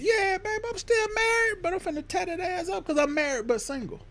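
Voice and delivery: high, soft pleading put-on voice; mock smooth-talking husband. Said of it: "I'd be like".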